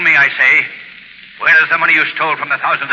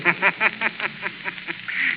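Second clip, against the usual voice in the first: eerie mocking laugh; 9 semitones higher, 9 dB quieter than usual. Shadow laughs at Burkett's boast.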